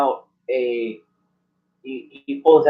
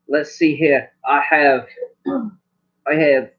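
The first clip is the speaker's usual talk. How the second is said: normal talking voice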